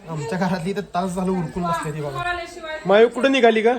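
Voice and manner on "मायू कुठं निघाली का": loud, higher-pitched call. Real, not acted. Uncle asking little Mayu where she is going, loud calling. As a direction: holler playfully after a little one.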